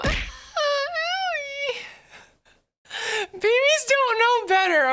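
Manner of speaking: high whiny cry of pain; fake crying. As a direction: wail like a hurt baby.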